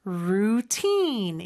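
"루틴" slow, with stress and a long vowel on 틴; second-syllable stress. Shown as a demonstration. Teacher modelling routine, "루틴" stressed long on the last syllable. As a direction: stretch "루틴", leaning on the second syllable. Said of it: "이음절의 강세가 있고 모음 소리는 길게".

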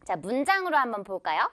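ordinary talk, nothing marked

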